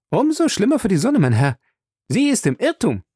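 high-pitched, proud retort; stubborn indignation. Acted. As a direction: stand by your watch, affronted. Passepartout stubbornly defends his watch.

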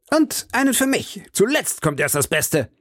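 high, furious battle cry; D'Artagnan strikes back at the nobleman, furious high cry. Acted. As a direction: spit it out in a rage, pitched high. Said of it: "schrie D'Artagnan wütend".

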